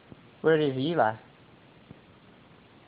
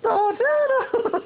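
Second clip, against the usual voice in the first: very high, very slow squeaky mouse voice; Mickey Mouse; slower than usual. Retold or imitated. Mother showing the baby Mickey's greeting, high and drawn out. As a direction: stretch it high like the cartoon mouse. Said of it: "What does a Mickey Mouse say?".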